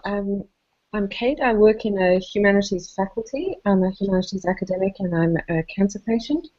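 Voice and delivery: ordinary delivery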